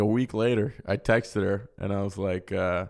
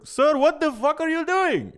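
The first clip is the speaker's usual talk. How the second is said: very high, drawn-out cry; mock alarmed driver. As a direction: call out in exaggerated high-pitched disbelief. Uber driver calls after him.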